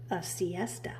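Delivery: hushed whisper, drifting off; sleepy whisper. A narrator's reading. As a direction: whisper it like a lullaby. Sleepy storybook line.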